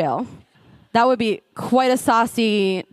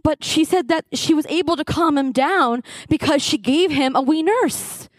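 ordinary delivery

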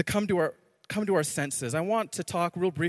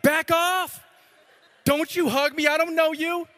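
high, loud retold protest; raised-voice mock outrage at overfriendly strangers; indignant warding-off; 9 semitones higher, 6 dB louder than usual. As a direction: push the huggers away with your voice. Retold recoil from strangers hugging him.

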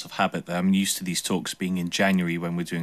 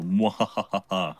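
mad scientist; slower than usual. Pretend. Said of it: "Evil smile".

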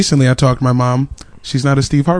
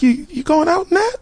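mom voice; 12 semitones higher, 4 dB quieter than usual. Retold or imitated. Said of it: "she was like".

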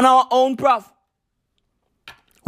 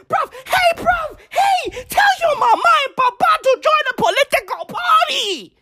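hammed-up crying shout; mock wailing rant; 13 semitones higher than usual. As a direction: wail it out like a desperate hustler.

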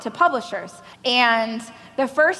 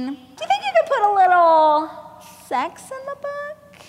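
coy request; 9 semitones higher than usual. Retold or imitated. Agent's awkward request quoted.

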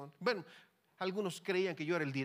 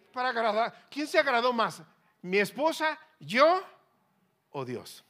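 loud, high preaching questions; emphatic challenge; 9 semitones higher, 15 dB louder than usual. Real, not acted. Preacher asks congregation who was most pleased, loud rhetorical challenge. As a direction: call each option out forcefully.

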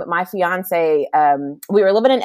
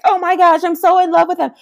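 put-on much higher, gushing in love with baby; gushing new mom; 11 semitones higher than usual. New mom gushing over her newborn, high and dreamy. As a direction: swoon like a smitten new mother. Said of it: "and you're like".

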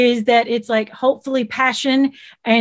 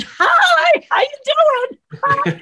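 put-on high, slow, stretched Southern accent greeting; drawn-out Southern hello, much higher and slower; big Southern drawl; much slower than usual. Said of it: "my big Southern".

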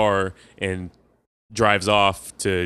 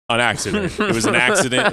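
sarcastic; 8 semitones higher, 3 dB louder than usual. Pretend. Sarcastic retelling of plot.

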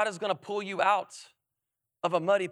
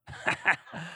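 laughing at the punchline; 3 semitones lower, 4 dB quieter than usual. Real, not acted.

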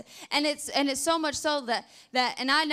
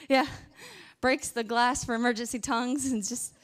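normal talking voice

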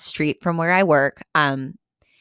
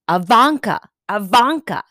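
imitated proclamation; 3 semitones higher, 5 dB louder than usual. Stranger proclaiming at her in the lunch line, loud mock proclamation. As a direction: proclaim the name like a mock herald. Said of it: "I hear this guy proclaim to me".

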